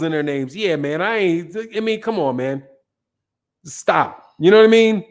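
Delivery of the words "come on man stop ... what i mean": ordinary delivery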